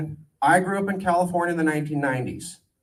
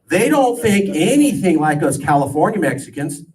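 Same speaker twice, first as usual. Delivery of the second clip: normal talking voice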